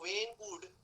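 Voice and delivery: very slow, drawn out words; slow model phrase. Shown as a demonstration. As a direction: stretch each word for the class. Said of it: "when के बाद दो number में could लगाना है". (बहुत धीरे खींचकर बोलना)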